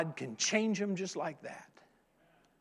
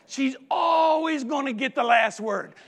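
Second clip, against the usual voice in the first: louder and higher exclamation; emphatic exclaim; 6 semitones higher, 5 dB louder than usual. Real, not acted. Preacher exclaiming about the dog, louder and higher. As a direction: proclaim it to the congregation. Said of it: "exclaims to congregation".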